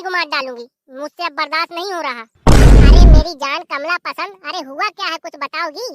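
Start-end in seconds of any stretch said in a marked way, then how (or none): 2.9-4.4 loud call across the house. Acted. Son yelling for his wife across the house. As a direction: shout her name to summon her.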